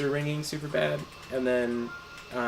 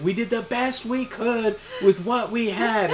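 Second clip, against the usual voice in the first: high, louder call across the street; raised, high mock yell; retold yell; 8 semitones higher, 5 dB louder than usual. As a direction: imitate him calling it out from afar. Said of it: "He came back yelling it".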